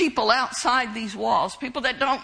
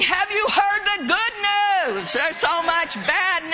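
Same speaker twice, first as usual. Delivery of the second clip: higher-pitched, upbeat announcement; excited proclamation; 6 semitones higher than usual. Shown as a demonstration. Preacher showing how to greet people when witnessing, excited proclamation. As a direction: proclaim it like glad news to share.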